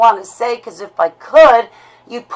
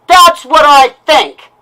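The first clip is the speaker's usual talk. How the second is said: loud and slow, spitting out that's; livid, words set apart; angry retort; 4 semitones higher, 6 dB louder, slower than usual. Real, not acted. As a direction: slam it down, bitter and blunt. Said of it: "what you just heard is a lash out".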